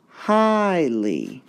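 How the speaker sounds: "highly" with a long, high HIGH and falling -ly; stress on first syllable. Shown as a demonstration. Teacher shows where the mark goes, stress on HIGH in "highly". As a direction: stretch and lift HIGH in "highly". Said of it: "would be stressed like that".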